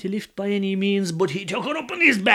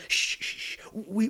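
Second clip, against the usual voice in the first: soft shushing; hushing; 5 semitones higher, 6 dB quieter than usual. Acted. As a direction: hush him gently. Crowd hushes Donal.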